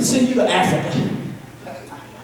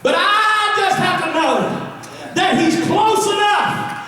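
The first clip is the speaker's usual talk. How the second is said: preaching at full force; 9 semitones higher than usual. Acted. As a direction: build it like a revival preacher.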